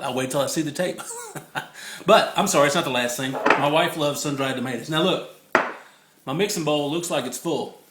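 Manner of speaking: normal talking voice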